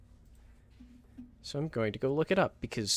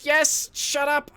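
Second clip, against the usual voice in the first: slow, drawn-out, much higher exasperated reply; stretched first syllable; 13 semitones higher, 3 dB louder, much slower than usual. Real, not acted. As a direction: drag out the yes wearily. Answering teasing chat.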